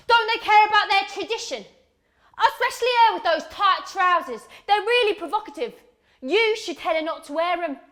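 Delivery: high, scandalised complaint; indignant scolding. Acted. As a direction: scold like an outraged busybody. Woman scolds about dress, acted.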